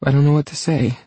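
high, tearful and overwhelmed; touched woman at a loss; wobbly voice. A narrator's reading. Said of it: "her voice wobbly".

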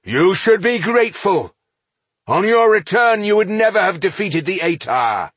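high, loud indignation; indignant retort. A narrator's reading. Dwarf demands gratitude from the elf, indignant. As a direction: throw it back at the accuser.

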